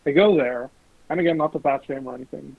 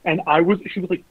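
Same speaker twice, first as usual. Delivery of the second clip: usual speaking voice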